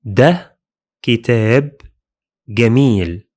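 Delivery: ده, كتاب, جميل spoken slowly with pauses between them; teacher spacing out each word of ده كتاب جميل. Shown as a demonstration. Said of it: "would be like this".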